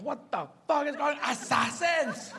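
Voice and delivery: high, exasperated outburst; alarmed dog owner. Acted. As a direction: demand to know what's happening. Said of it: "the owner comes out and goes".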